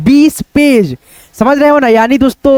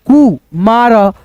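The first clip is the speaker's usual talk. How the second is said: slow, two separate syllables; कु, मार split slowly for a child; sounding out; much slower than usual. Shown as a demonstration. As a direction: say कु then मार apart. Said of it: "Kumar, ऐसे करके".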